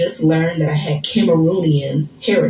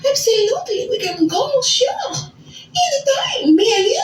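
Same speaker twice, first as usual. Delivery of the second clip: fake French accent. Woman eagerly accepting a date in a fake French accent. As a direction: squeal it in an over-the-top French accent. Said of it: "I spoke in this French accent".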